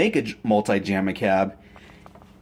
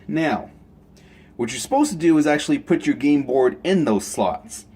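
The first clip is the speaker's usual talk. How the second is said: ordinary talk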